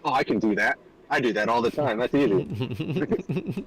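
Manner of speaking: overconfident and upbeat, rising high; breezy mock bravado; cocky. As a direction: brag like it's nothing. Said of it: "I was like".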